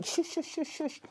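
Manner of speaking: anxious shushing mum. Acted. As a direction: hush the baby, a little anxious. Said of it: "Mum responds by going".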